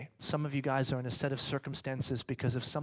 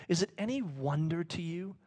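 normal talking voice

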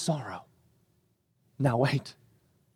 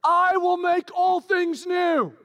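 booming shout; 12 semitones higher, slower than usual. Acted. God proclaiming to John, a slow high shout. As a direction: shout it slowly to the heavens. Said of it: "God's like".

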